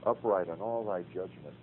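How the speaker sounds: soft, hushed reading of a psalm as prayer. Shown as a demonstration. Teacher shows class how he prays the Psalms, hushed. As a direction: pray the psalm quietly to the Lord. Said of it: "Just pray through the Psalms".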